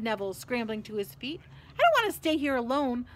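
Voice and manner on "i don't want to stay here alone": high, loud, worried plea; anxious pleading. A narrator's reading. Neville fears staying in the corridor alone, pleading. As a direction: beg with raised pitch and volume. Neville begging not to be left.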